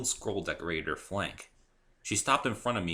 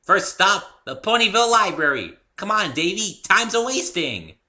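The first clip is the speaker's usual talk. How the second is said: very high, loud, impatient cheerful voice; eager pony; 13 semitones higher, 12 dB louder than usual. A narrator's reading. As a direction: hurry him along with glee. Pinkie hurries Dave along.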